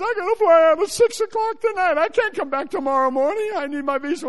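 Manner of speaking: high, loud mock wailing; imitated moans and cries. Crowd begging for visas before their flights, hammed-up high wailing. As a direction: wail it like a frantic, pleading crowd. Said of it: "their moans, their groans, and their cries".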